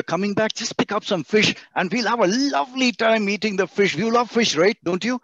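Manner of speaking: high and lively, selling the idea; playful persuasion; coaxing, eager. Shown as a demonstration. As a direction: coax them into loving fish. Voicing a warm persuasive request.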